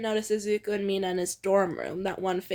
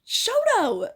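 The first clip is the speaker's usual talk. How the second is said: much higher, scandalized cry of the name; shocked gasp; 11 semitones higher than usual. A narrator's reading. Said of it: "Izuku gasps".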